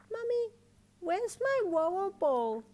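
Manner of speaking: put-on high, slow small child; sleepy toddler protesting; little girl voice. A narrator's reading. Daughter insisting to her dad, small child voice.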